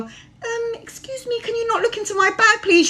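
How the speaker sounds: very high, thin, mousy voice; mock a meek, overly polite ask; timid polite request. Mocking how you shouldn't ask a stranger, high and meek. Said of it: "you don't go".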